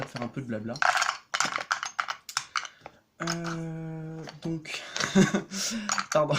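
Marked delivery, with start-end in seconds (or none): 4.9-6.1 sudden loud burst of laughter; real laugh. Real, not acted. Vlogger laughing at a memory that just came back, real laugh. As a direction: laugh out loud, higher than your talking voice. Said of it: "je rigole parce que c'est".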